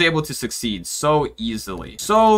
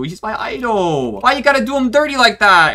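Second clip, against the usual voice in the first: higher and louder, mock-offended; mock outrage; 10 semitones higher, 6 dB louder than usual. Arguing with staff who insult SpongeBob, mock outrage. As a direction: defend your hero with hammed-up indignation.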